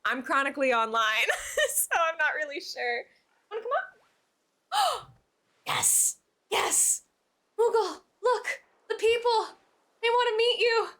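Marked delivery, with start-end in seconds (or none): 3.5-7.0 breathless whispered cheer; excited whisper. Real, not acted. Excited whisper as cat comes. 7.6-10.9 high thrilled squeal to the cat; excited. Real, not acted.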